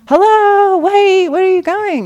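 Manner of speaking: high, loud panicked cry after a departing guide; panicked calling out. Retold or imitated. Abandoned in desert, calling after guide.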